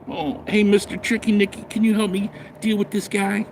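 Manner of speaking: a hint of a sweet-talking woman; mock sweet plea. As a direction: mimic her asking a favor, sugary. Mocking a woman asking for help.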